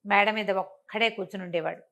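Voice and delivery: plain reading voice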